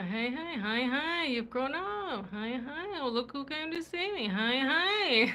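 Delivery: singsong, swooping welcome; cooing acted surprise, lilting up and down; pleasant surprise. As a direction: greet a visitor with drawn-out, delighted surprise. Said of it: "like, yeah, like, surprise".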